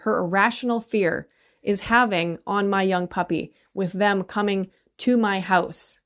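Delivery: ordinary talk, nothing marked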